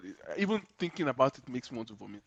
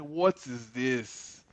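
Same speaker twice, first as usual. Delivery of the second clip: drawn-out baffled question, slow; slow disbelief; much slower than usual. Retold or imitated. To himself watching One Piece on the telly, slow baffled disbelief. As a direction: stretch each word in mock disbelief. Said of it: "I'm just like".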